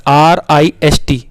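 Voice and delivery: letters आर आई एस टी spaced out slowly; spelling slowly. Shown as a demonstration. Teacher spelling a word out slowly for children. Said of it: "अब इसे पढ़ना ज़रा".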